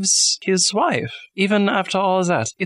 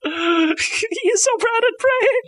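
squealing with laughter, high-pitched; laughing; much slower than usual. Real, not acted. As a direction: barely get it out through laughter. Cracking up at the story.

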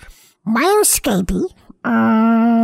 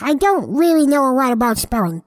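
normal talking voice

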